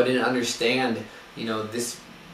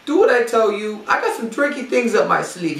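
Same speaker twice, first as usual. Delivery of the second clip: much higher, louder Jamaican accent, hammed up; 12 semitones higher, 8 dB louder than usual. Coach answering the frustrated runner, in a hammed-up Jamaican accent. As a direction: play the new coach in a broad Jamaican accent. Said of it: "my best take out of Jamaican accent".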